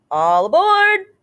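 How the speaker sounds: high, slow, ringing "all aboard" call; conductor's call. A narrator's reading. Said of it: "the conductor calls".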